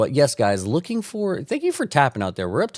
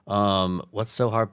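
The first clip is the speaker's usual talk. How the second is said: normal talking voice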